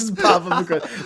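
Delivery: usual speaking voice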